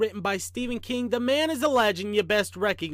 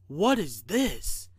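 slow, uneasy storybook question; mildly worried engineer; 3 semitones lower, much slower than usual. A narrator's reading. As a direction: ask it slowly, with a touch of worry. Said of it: "Engineer Bob asked in a worried voice".

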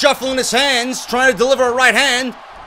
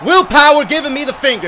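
higher and louder, yelling in disbelief; outraged shout; 5 semitones higher, 5 dB louder than usual. Real, not acted. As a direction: yell it at the screen. Outraged repeat at the rival.

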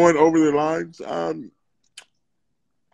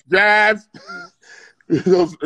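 ordinary delivery

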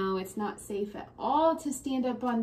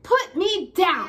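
put-on angry child; 7 semitones higher, 8 dB louder than usual.

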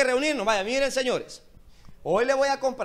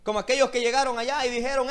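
ordinary talk

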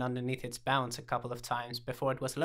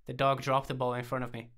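usual speaking voice

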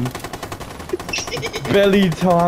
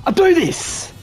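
triumphant shout; 10 semitones higher, slower than usual. Real, not acted. Gamer to teammates after the win, triumphant shout. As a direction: yell it out to the lads.

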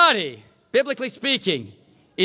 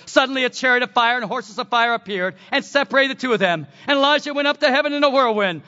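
ordinary talk

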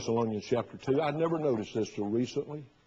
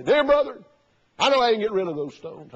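high, loud sing-song "Dear brother"; hamming up the old man's syrupy greeting; mock sweet greeting; 7 semitones higher, 5 dB louder than usual. Preacher imitating Brother Jones greeting him.

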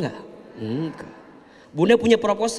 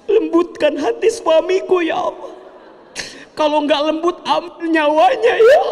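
hammed-up desperate prayer; 11 semitones higher than usual. Mock desperate prayer about husband.

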